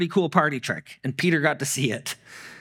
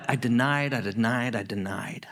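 fading remorse; 4 semitones lower than usual. Retold or imitated. Peter admitting his failure, fading into shame. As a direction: let the confession sink to near nothing.

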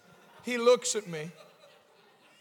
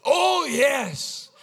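put-on drawn-out, loving Central African accent; imitated Central African accent; 7 semitones higher, 8 dB louder than usual. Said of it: "like Central African".

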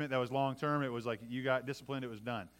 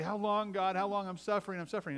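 mock whine, pitched up; plaintive complaint; 4 semitones higher than usual. People crying to God in suffering, plaintive.

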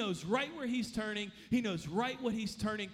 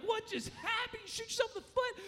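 much higher, fast startled question; shocked disbelief; 12 semitones higher than usual. Retold or imitated. Friends react to an accidental gunshot, high startled disbelief. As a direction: blurt it out as if a gun just went off beside you. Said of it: "We were like".

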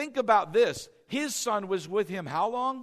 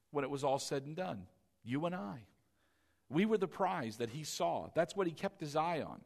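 much softer, intimate and lower; tender hushed preaching; 6 semitones lower, 7 dB quieter than usual. Acted. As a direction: draw them in close and gently. Sermon drops to an intimate hush.

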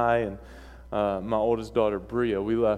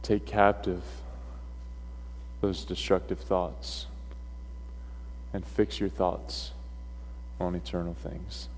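solemn sermon closing; 5 semitones lower, 6 dB quieter than usual. Real, not acted. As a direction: bring the message home softly, with long pauses. Preacher's solemn closing exhortation.

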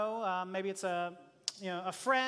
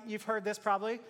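ordinary talk, nothing marked

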